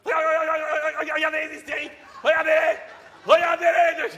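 loud, agitated calling out; shouting. Acted. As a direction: point and shout at them. Host shouting at the fighting guests.

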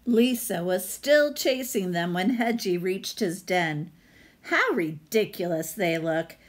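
lively read-aloud storyteller voice; story narration. Acted. Teacher reading a picture book to her class, storytelling voice.

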